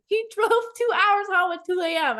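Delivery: rising, amazed, laughing through it; excited disbelief. Real, not acted. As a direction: tell it like you can't believe it. Amazed at his long drive.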